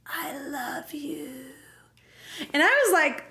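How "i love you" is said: slow, breathy whisper; mock dying whisper. Dying Steve confessing to Claire, slow faint whisper. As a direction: breathe out hammed-up last words.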